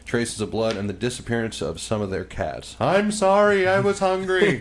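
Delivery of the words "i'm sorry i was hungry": sheepish lizard apology. Retold or imitated.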